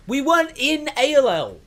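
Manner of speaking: loud, high-pitched objection; indignant protest. Real, not acted. Correcting the game's mistake to a co-host, indignant protest. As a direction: burst out in loud disbelief.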